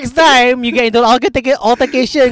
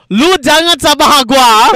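mock tough talk; 5 semitones higher, 5 dB louder than usual. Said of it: "You get into a fight".

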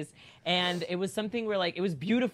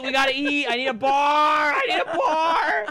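louder, higher pleading wail; hungry whining plea, rising to a cry; desperate whine; 9 semitones higher, 8 dB louder than usual. Retold or imitated. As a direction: beg for food like you're starving. Starving, desperate.

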